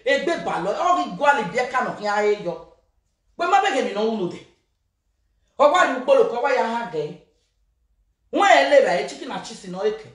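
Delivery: fast, rattled-off torrent; rapid rant. Acted.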